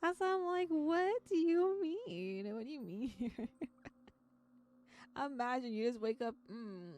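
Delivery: normal talking voice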